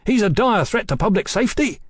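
high, alarmed insistence; agitated warning. Acted. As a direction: press the danger urgently. Mallory insists on the danger.

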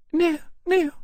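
very high, loud, nervous refusal; pale alarm. A narrator's reading. Lady declines to approach the dragon, faintly alarmed. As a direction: back away in a high lady's voice. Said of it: "she said palely".

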